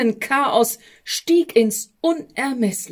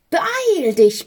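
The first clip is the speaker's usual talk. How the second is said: much higher, louder strange voice urging; urgent call; 7 semitones higher, 3 dB louder than usual. A narrator's reading. Stress and Hectic urge the envoy from the sack, urgent call. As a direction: push him to hurry, high and shrill. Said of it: "riefen da der Stress und die Hektik".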